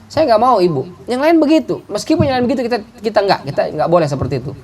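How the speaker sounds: a daughter's voice pitched high, firm refusal; firm young girl. Retold or imitated. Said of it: "dia bilang". (suara gadis yang tegas menolak)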